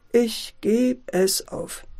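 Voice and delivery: "ich geb es auf" drawn out slowly; slow resignation. Acted. Father resigns, repeating it slowly. ("ich geb es auf" langsam und resigniert)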